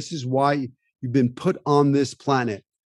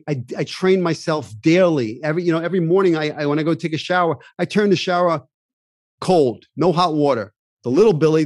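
ordinary talk, nothing marked

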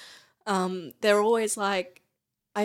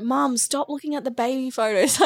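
higher, louder mimicked telling-off; imitated scolding daughter; 6 semitones higher, 5 dB louder than usual. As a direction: lightly mimic a daughter snapping at her mum. Imitates daughter snapping her out of crying.

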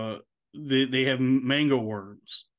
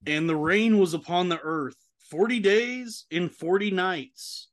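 higher, measured Bible-reading voice; scripture reading; 4 semitones higher, slower than usual. Acted. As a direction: read the verse aloud like a lector. Reads Genesis verses aloud.

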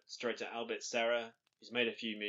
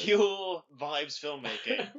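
laughing mock pronouncement; 5 semitones higher, 6 dB louder, much slower than usual.

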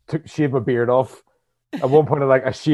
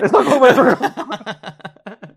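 much higher, slow, laughing through it; a mock-insistent denial through laughter; laughing protest; 11 semitones higher, 4 dB louder, much slower than usual. Real, not acted. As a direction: drag "It's not covid" out in giggles. Repeats denial, second time marked.

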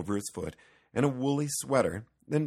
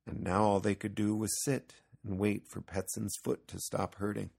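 usual speaking voice

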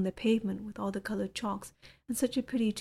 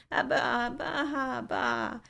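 put-on higher, wailing baby abba with a rising whine; crying baby babble; 5 semitones higher, slower than usual. A narrator's reading. The baby fussing while his nappy is changed, higher wailing babble. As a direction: wail it like a fussing infant.

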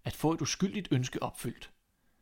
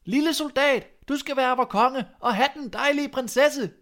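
crowd shouting; 12 semitones higher, 7 dB louder than usual. A narrator's reading. The people hail the soldier as their new king, shouting high and loud. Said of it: "alle folkene råbte".

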